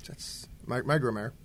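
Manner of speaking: mumbled through a mouthful; chewing while talking; mouth full. Real, not acted. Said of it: "I have food in my mouth".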